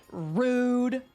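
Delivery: usual speaking voice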